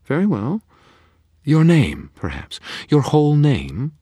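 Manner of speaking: high, loud coaxing bargainer; eager faerie. A narrator's reading. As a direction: dangle the offer sweetly. Faerie names her price.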